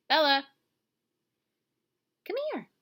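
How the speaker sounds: high loud call to a pet; calling dog. Real, not acted. Teacher calling her dog to the camera, high call.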